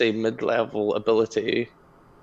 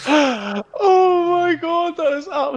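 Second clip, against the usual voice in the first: laughing; 3 dB louder, slower than usual. Real, not acted. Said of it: "laughing at story".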